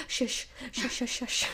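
soft, breathy shushing. Retold or imitated. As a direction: hush a barking dog quietly.

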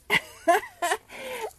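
high-pitched burst of laughter; bright peal of real laughter; laughing. Real, not acted. Laughs at the vet's joke.

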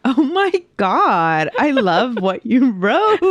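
high, drawn-out gushing; delighted amusement. Real, not acted. Co-host tickled by the line her partner wrote, delighted. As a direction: gush slowly, breaking into laughter.

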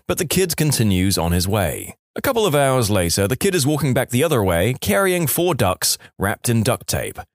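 usual speaking voice

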